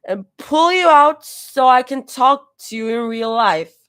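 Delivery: loud, earnest tipsy voice; drunk voice. A narrator's reading. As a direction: slur it out, dead serious. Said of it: "dead serious".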